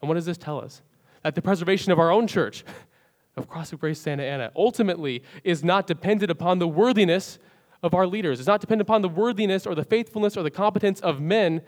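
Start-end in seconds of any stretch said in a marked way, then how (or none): 1.2-11.6 loud, high, building preacher's cry; full-force preaching. Real, not acted. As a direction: drive it home from the pulpit, loud and high.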